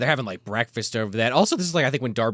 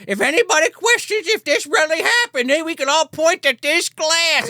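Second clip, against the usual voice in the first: proud barmaid; 14 semitones higher, 4 dB louder than usual. Retold or imitated. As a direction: announce it to the whole pub. Barmaid proclaiming over the glass.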